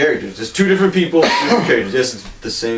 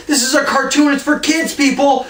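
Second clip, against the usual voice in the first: high, mocking director voice, much higher; mocking Schumacher; 13 semitones higher, slower than usual. Mocking the director Schumacher, in a high squeal. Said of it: "Schumacher was just like".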